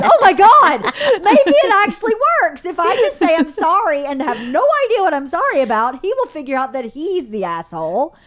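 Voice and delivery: hammed-up high-pitched epiphany; astonished realization. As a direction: exclaim it like a sudden revelation. Retold gleeful realization after his apology.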